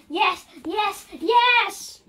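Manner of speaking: gleeful rising cheer; excited yes. Pretend. Eggman cheers for his event.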